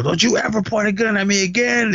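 high, sharp imitated threat after a slap; imitating a friend warning her after a slap; mock furious warning.

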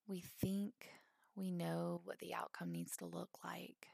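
calm, spacious phrases with long pauses; guided meditation. Acted. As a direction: gently guide them into letting go.

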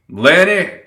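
loud, high call. A narrator's reading. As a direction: call for the boy across a crowd. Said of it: "Summer called out".

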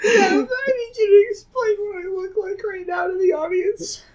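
high, teary laughing voice; laugh-crying. Real, not acted. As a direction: speak through tears of laughter. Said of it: "full of tears".

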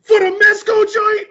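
high, loud excited yell; airport scream. Retold or imitated. Screaming in the airport about the toy, loud. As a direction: scream it across the terminal. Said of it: "screamed mad loud in the airport".

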